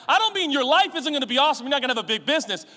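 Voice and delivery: ordinary talk, nothing marked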